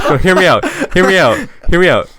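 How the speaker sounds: loud repeated plea to listen; insistent. Real, not acted. Pleading with friends to listen, loud and insistent.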